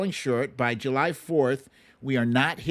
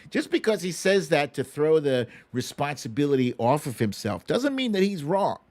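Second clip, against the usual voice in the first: usual speaking voice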